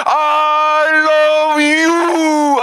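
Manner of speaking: God shouting his love back; drawn-out shouted love. Acted. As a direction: cry it out with all your heart. God's answer shouted.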